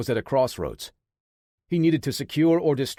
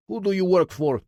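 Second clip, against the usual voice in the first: put-on higher, louder interrogator with a Russian accent; thick Russian accent; 7 semitones higher, 4 dB louder than usual. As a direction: press him in a heavy Russian accent. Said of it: "in a thick Russian accent".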